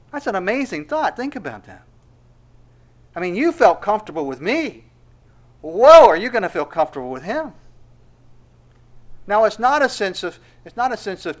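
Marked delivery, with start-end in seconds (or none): 3.1-7.5 rising to a loud pulpit question; building sermon intensity; preaching build. Acted. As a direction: drive the question home to the congregation.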